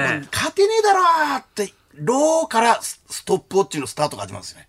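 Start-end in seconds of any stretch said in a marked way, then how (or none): 0.0-1.4 high, long-drawn cry of giving up; mock fan's wail. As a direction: stretch the last syllable right out, pitched high. 諦めた阪神ファンの叫びを再現. (諦めたファンの甲高く伸ばす叫び)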